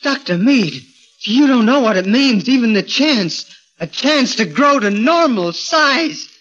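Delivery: fervent eagerness. Acted. Gorgo begs the doctor for the chance, high and fervent. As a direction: pour out your longing.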